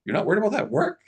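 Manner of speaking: high playful question; teasing question. Retold or imitated. Boss teasing employee about the work, high and playful. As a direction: needle her, up in the voice. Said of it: "I said".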